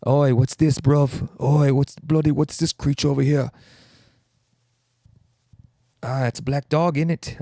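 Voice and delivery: hammed-up English accent, calling out; bad English accent. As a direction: put on a rough imitation of an English bloke. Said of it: "I'm gonna stop doing the voices".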